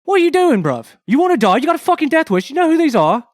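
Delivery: menacing shout. Acted. Red cloak threatening Jason, shouting angrily. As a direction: scream threats in his face.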